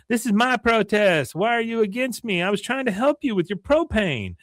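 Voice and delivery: imitating an aggrieved young woman, high; Luann protesting. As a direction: whine it down from above.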